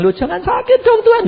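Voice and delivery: high-pitched mock protest to God; protesting plea. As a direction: imitate a worried wife objecting. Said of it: "lalu engkau bilang".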